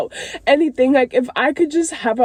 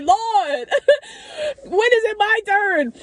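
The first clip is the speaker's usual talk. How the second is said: high tearful whine breaking into laughter; playful wail of complaint through tears; mock-mad pleading; 10 semitones higher than usual. Jealous believer asking God for a turn, mock-mad. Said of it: "I'll be mad too".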